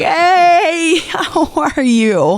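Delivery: high, sugary mock greeting drawn out; fake friendly greeting. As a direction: gush a hello you don't mean.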